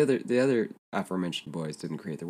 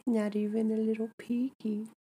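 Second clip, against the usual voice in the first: high playful coy voice; teasing moon voice. Pretend. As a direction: tease like a shy goddess.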